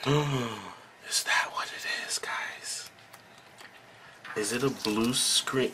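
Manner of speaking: hushed gasp of sudden realisation; whispering. Real, not acted. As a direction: drop to a breathy whisper. Sudden idea, speaking to viewers.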